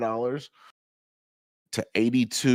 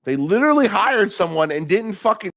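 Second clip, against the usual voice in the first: worked-up rant; 8 semitones higher, 9 dB louder than usual. Real, not acted. Complaining about staff.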